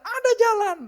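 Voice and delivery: very high, very loud retort; acted yell, brooking no doubt; insistent shout. Husband insisting to the driver there is a road, shouting.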